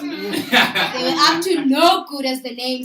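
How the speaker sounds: loud and slow through laughter; laughing. Real, not acted. Telling listeners about the school gang, laughing loudly.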